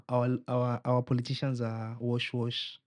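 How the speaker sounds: ordinary delivery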